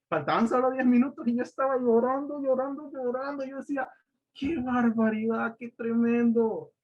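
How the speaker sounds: high-pitched, emotional exclaiming; retold overwhelmed awe. Preacher recalling tears minutes before the pulpit, retold overwhelmed awe.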